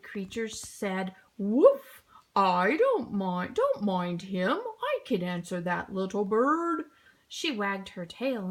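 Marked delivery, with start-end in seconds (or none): 1.4-2.0 loud woof like a dog; dog bark. A narrator's reading. Dog barks. 2.3-6.9 friendly dog. A narrator's reading. Dog voice, lower.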